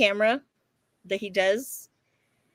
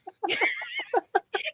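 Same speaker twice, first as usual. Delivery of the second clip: laugh; 4 dB quieter than usual. Real, not acted. Laughing at own story.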